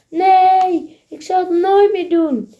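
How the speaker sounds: high, loud wailing plea; crying out. Acted. Thief caught by police, loudly pleading. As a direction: beg at full voice. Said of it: "roept de dief".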